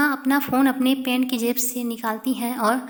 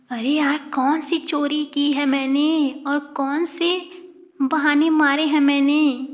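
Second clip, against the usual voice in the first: louder, rising exasperation at a false charge; exasperated protest; 2 semitones higher, 6 dB louder than usual. Acted. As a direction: push back hard, fed up and louder. Karishma denies accusation heatedly.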